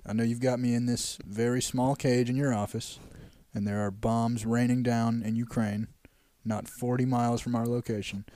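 low, soft, measured plea to a captor; hushed action-hero bargaining; captured secret agent. Acted. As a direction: negotiate quietly and gravely, pitched low. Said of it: "He's like".

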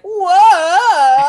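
high, loud hammed-up yell; imitating an actor's cheesy fall yell; mock whoa. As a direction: cry out like falling on a green screen. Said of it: "just going like".

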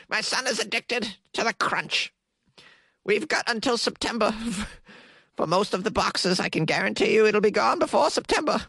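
high, put-on old lady; Carrie, ancient woman. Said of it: "That's how she talks".

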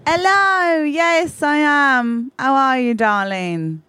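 put-on high, drawn-out star accent gliding downward; theatrical celebrity accent, very high at first; Poppy Poppins. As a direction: greet a fan in a grand put-on accent. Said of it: "With the accent?".

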